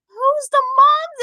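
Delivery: high, loud acted bafflement; hammed-up parental disbelief; incredulous question.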